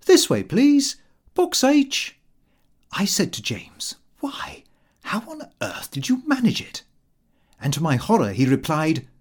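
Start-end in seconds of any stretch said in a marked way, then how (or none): 0.1-2.2 high-pitched, polite usher; very civil attendant. A narrator's reading. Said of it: "a very civil attendant said". 4.2-6.8 hushed, astonished whisper; whispered aside. A narrator's reading. Narrator discreetly asks James in theatre.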